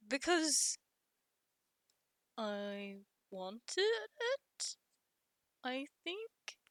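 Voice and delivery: slow, halting and hushed answer; soft, hesitant. Acted. Character admits wanting to please Guppy, soft and hesitant. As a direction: quietly admit it, feeling for each word.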